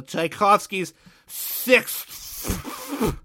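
"sixth" very slow, with a long hissy ending; exaggerated pronunciation. Real, not acted. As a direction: stretch out the th of "sixth" absurdly. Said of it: "trying to say it intentionally".

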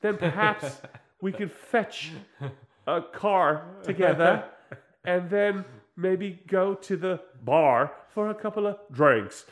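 slow, halting Australian failing at American; terrible wobbly Australian-American accent, hammed up; botched American accent. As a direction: mangle an American accent as an Australian actress, slowly. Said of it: "an Australian actress trying to do an American accent".

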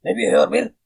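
loud, high mock granddad yell; abuelo regañón. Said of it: "el abuelito le había dicho". (grito imitado de abuelo regañón)

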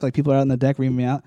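ordinary delivery

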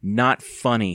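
usual speaking voice

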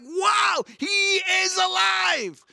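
high, loud, drawn-out proclamation; full of enthusiasm. Retold or imitated. Women full of fun telling the news, enthusiastic shout. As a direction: shout the amazing news with joy. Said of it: "They were just full of enthusiasm".

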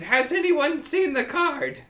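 high, slow questioning call; kobold boss. Acted. Kobold boss questions his crowd, high slow call. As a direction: ask the crowd in a squeaky voice. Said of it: "he says".

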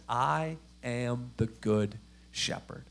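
low, soft, very slow and measured; gentle pastoral statement. Retold or imitated. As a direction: say it softly, word by word. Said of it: "like, pastoral, nice statement".

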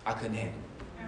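low, hushed admission to oneself; quiet realization. Acted. Believer realizing his faith fell short, quiet admission. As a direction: let it sink in, softly and low. Sober realization after the aha moment.

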